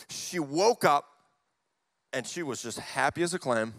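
ordinary talk

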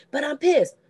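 high, loud mock pissed-off yell; imitating an angry husband; mock angry outburst. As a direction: blurt it like a man losing his temper. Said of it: "And he's like".

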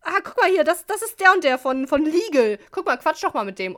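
high, loud, gushing introduction at a party; imitated chatty colleague. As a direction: play the outgoing marketing woman making introductions. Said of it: "die war so".

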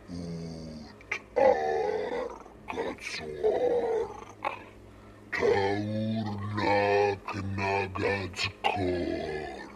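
possessed, speak in a deep alien tongue; cavernous inhuman voice. Acted. Possessed madman speaks the monster's words, cavernous and inhuman. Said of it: "la sua voce si fece spaventosamente cavernosa e inumana".

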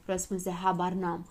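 usual speaking voice

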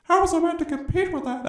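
hammed-up lecturing impression, shooting way up in pitch; mock J B P voice. Friend mocking a pundit's incel rant: high hammed-up impression. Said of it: "I gotta break out the J B P voice".

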